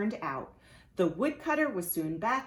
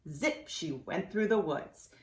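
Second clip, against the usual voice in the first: ordinary delivery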